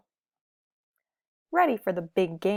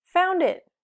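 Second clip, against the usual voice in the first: excited; 9 semitones higher, 5 dB louder than usual. A narrator's reading. Argyle finds his costume.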